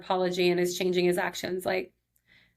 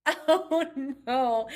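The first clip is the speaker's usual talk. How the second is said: laughing oh no; 6 semitones higher than usual. Real, not acted. Embarrassed laugh at the news.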